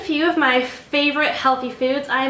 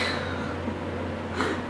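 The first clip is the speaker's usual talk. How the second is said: soft laugh; 8 dB quieter than usual. Real, not acted. Outtake laugh.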